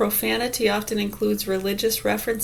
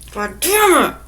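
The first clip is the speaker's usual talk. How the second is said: raised, forceful swearing; high-pitched mock outburst; acted angry curse; 7 semitones higher, 4 dB louder than usual. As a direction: let out aggression on the curse. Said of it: "I'm using anger".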